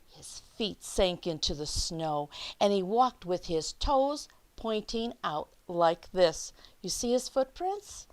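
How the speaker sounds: lively picture-book read-aloud; reading to children. Acted. Teacher reading The Snowy Day to her class, lively read-aloud. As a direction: read it out like story time for little kids.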